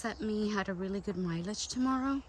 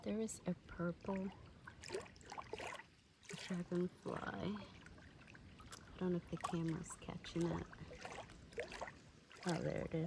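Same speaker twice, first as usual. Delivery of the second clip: hushed; 11 dB quieter than usual. Real, not acted. Spotting a dragonfly, not scaring it.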